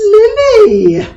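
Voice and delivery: high, loud scandalised cry of the name; playful shocked rebuke to her friend; shocked exclamation. Acted. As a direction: call her out in mock outrage.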